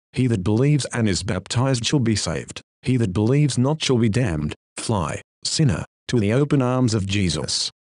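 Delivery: ordinary talk, nothing marked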